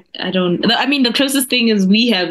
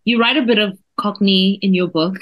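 ordinary talk

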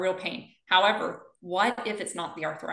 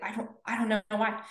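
higher, tearful mock voice; imitating tearful confusion; 4 semitones higher than usual. Physical therapist retelling a patient's breakdown, imitated tearful confusion. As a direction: act out a patient baffled by tears. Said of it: "broke down and started crying".

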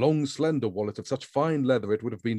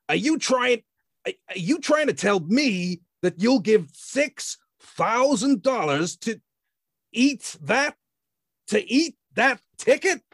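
much higher, louder outraged heavy; angry exasperation; 12 semitones higher, 5 dB louder, slower than usual. A narrator's reading. The man outraged at Grand's offer, high and loud. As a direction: spit out the disbelief. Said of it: "angry exasperation".

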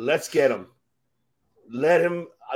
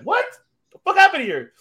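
mock stunned outburst rising much higher and louder; shocked disbelief; 6 dB louder than usual. Host imitating his co-host's shock at the upset, stunned outburst. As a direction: blurt it as if you can't believe your eyes. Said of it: "You're like".

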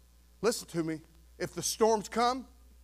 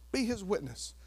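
ordinary delivery

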